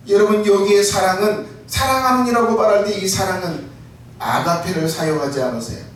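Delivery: normal talking voice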